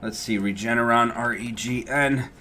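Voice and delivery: usual speaking voice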